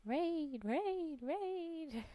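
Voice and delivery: high sing-song chant; high, drawn-out cheer; raid cheer. Real, not acted. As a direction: chirp each word high, pitch falling away. Chants to greet an arriving raid.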